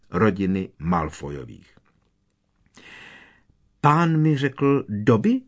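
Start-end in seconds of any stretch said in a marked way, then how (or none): 3.8-5.4 high, slow elf squeak; tiny elf asking, high and drawn out; curious squeak. A narrator's reading. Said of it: "vypískl skřítek zvědavě".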